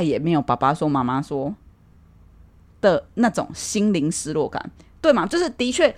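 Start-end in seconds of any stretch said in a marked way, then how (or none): none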